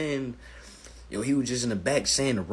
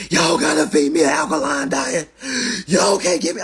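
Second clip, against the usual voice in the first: loud imitated yelling from the back; imitating screaming; 2 semitones higher, 12 dB louder than usual. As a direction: holler it out like a locked-up man demanding. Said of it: "heard a brother back there screaming".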